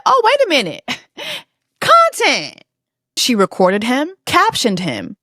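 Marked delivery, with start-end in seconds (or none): 0.0-2.6 imitating a giddy clout chaser, high; high scheming voice. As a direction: squeal it with a laugh, high. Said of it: "Olivia said".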